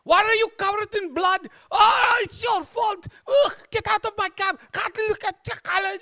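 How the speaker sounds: very high, loud hammed-up outburst; frantic cabbie. Cabbie blaming his bloodied passenger, frantic. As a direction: shriek and sputter in a piercing high voice. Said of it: "the cabbie was complaining".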